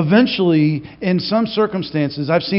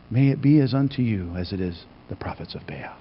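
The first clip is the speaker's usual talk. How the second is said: unvoiced, softer hissing threat; menacing whisper; 7 semitones lower, 7 dB quieter than usual. Jezebel threatening Elijah, menacing whisper.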